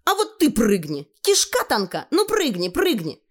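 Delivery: high, jeering dare; taunting gang leader. Acted. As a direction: egg him on to jump. (вожак мальчишек подзадоривает, высоко и насмешливо)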